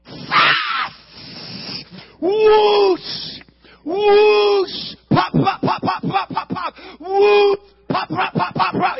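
high, loud whoosh and pop; shouted fireworks noises. Retold or imitated. Retelling the rocket launch, shouting the whoosh and pops. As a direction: yell the rocket's flight.

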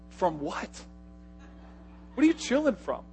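incredulous. Retold or imitated. Incredulous pastor questions student.

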